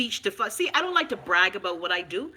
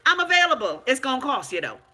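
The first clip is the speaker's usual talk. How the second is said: indignant retort; 6 semitones higher, 6 dB louder than usual. Retold or imitated. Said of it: "I hear people ask me".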